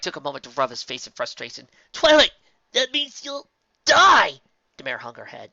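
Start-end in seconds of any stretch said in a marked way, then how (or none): 1.9-4.4 high, loud, slow horrified cry; horrified shout. A narrator's reading. Spike crying out to Twilight in horror. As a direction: stretch it out in horror.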